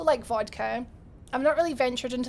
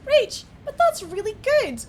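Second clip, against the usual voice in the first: lightly voiced imagined viewer, a touch higher; quoting an imagined chatter in a light mock voice; 9 semitones higher, 4 dB louder than usual. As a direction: play the cheery viewer, slightly raised. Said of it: "which you might think".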